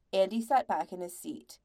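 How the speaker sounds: ordinary delivery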